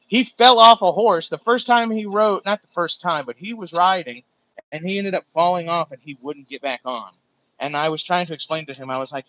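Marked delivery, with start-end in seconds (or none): 0.1-5.9 loud, worked-up storytelling; excited telling. Real, not acted. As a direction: really get going on the story. Loudly recounting worker's fall.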